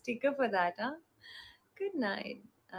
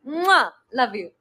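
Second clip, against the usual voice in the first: blowing a kiss; 9 dB louder than usual. Real, not acted.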